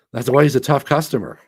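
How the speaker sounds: loud, punchy praise; emphatic. Real, not acted. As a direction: hit it hard and loud.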